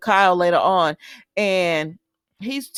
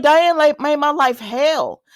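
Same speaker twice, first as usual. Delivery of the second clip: higher, louder outburst; upset quote; 7 semitones higher, 4 dB louder, slower than usual. Retold or imitated. Phyllis complains about Diane in a higher, louder outburst. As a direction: vent it like she's fed up. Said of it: "she was like".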